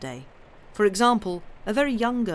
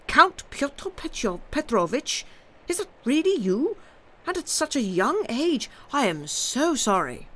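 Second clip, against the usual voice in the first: high, surprised exclamation; surprised old general; 6 semitones higher than usual. A narrator's reading. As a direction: gasp it in surprise. General surprised greeting young count.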